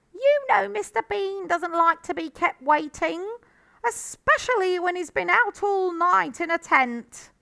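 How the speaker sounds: loud, high-pitched woman nagging from afar; calling out. A narrator's reading. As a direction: call the reminder through the house. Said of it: "the voice called".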